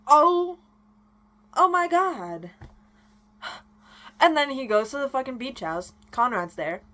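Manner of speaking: ordinary talk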